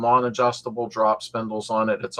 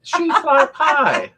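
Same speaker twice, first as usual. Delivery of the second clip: high, loud, drawn-out laughing words; helpless high-pitched laughing protest; 7 dB louder, much slower than usual. Real, not acted. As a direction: squeal it slowly through laughter. Laughing while arguing about pie.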